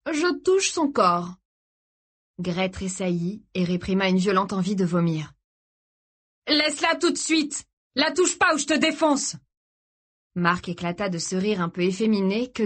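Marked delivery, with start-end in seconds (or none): 0.1-1.4 high, drawn-out provocation; slow taunt. A narrator's reading. Ravisseur provoque le frère. 6.5-9.4 high, enraged protective outburst; furious threat. A narrator's reading. Frère enragé menace.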